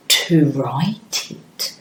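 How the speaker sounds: soft whispered aside. Shown as a demonstration. Teacher whispering to young pupils. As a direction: drop to a hush for the class.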